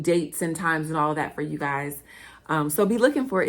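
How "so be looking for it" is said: ordinary delivery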